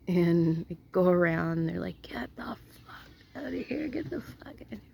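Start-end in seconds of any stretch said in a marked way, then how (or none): 2.0-4.8 high, hushed whisper-shout; mock whispered yell. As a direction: whisper it like yelling someone away, very softly. Retelling neighbours chasing off a man.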